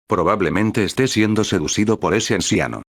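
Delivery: plain reading voice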